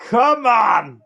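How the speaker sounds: high, rising hype shout; excited cheer. Real, not acted. Vlogger cheering the old NXT logo's return, hyped shout. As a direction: hype it up like a rowdy fan.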